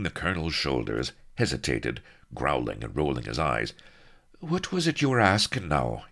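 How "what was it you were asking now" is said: high-pitched, eager inquiry; inquiring. A narrator's reading. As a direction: ask as if suddenly willing to cooperate. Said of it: "inquired the colonel".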